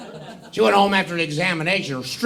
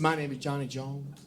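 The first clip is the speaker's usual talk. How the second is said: put-on softer, lower prim little-girl recital; prim student; 6 semitones lower, 14 dB quieter than usual. A prim A student reciting her name to the teacher.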